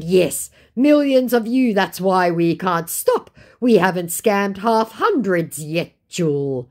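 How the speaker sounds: hammed-up scammer gloat; mock scammer boast. Voicing scammer.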